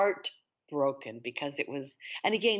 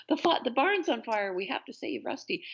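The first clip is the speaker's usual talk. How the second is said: lightly hammed-up alarm, higher pitch; mock TV melodrama; 7 semitones higher than usual. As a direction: play urgent rescue dialogue, tongue in cheek. Mimics urgent Lassie-style TV dialogue.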